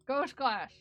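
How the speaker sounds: talking with a pin between lips; muffled through a held pin; pin in mouth. Real, not acted. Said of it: "Sorry, I got a pin in my mouth".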